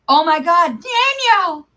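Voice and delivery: high, loud cry of disbelief; shocked outburst. Real, not acted. Streamer shouting a name at the chat, stunned.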